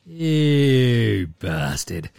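slow, low mock resentment; long groaned insult; drawn-out cursing. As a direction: stretch it out in defeated disgust. Retold grumbling at the dog.

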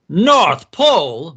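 scoffing boss's loud outburst; cackling disbelief. A narrator's reading. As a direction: boom it out, slow and incredulous. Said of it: "B Z cackled".

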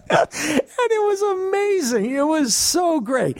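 high, slow words squeezed through laughter; barely getting it out for laughing; real giggling delight. Real, not acted. Laughing recalling the customer.

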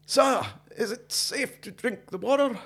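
high, slow put-on English accent; best English accent. English camper asking an old Highlander, English accent. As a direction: play a polite Englishman in an exaggerated English accent. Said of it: "My best English accent".

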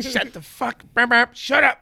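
high, loud hammed-up drunk voice, burping; mock drunk hush. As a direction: burp and shout at the friend, hammed up.

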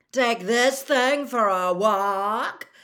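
slow, loud, disgusted sneer; slow loud scornful disbelief. Acted. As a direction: spit the words out in revulsion. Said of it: "he repeated in disgust".

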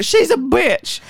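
high-pitched mimicked accusation; hammed-up, high indignant quote; mock outburst. Acting out Deandra's outburst.

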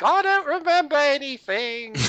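high, put-on Australian accent as Claire. Imitating Claire in an Australian accent. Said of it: "that was my best Australian accent".

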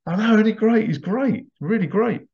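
high excited fan gush. Retold or imitated. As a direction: rave about him like a fan. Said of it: "It's like".

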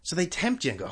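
ordinary talk

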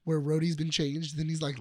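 ordinary delivery

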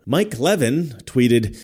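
ordinary talk

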